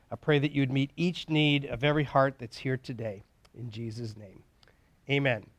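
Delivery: quiet opening prayer before the talk. Acted. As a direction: pray gently over the group. Leads a word of prayer.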